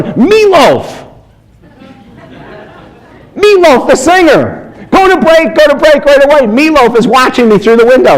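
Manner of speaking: yelping go to break in panic; frantic producer. Retold or imitated. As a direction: mimic a producer losing it. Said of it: "freaking out".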